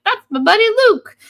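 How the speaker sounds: excited put-on voice, high and loud; Benji. Benji stoked to see his buddy, high excited voice. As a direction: burst out super stoked, quick and high-pitched. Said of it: "that was my voice for Benji".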